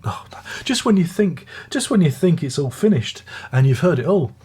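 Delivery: ordinary talk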